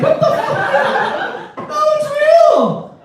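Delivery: very high, drawn-out mock squeal; hammed-up indignant squeal; squealed protest. Acts out his protest to her.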